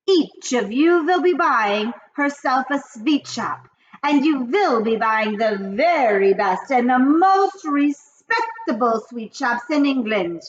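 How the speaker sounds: high, loud witch rant. Acted. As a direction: rage like the Grand High Witch. Grand High Witch speech.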